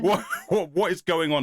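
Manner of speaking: high-pitched imitation of a bewildered TARDIS; hammed-up, half-laughing cry of confusion; mock shouting. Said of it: "stop shouting at me, tardis".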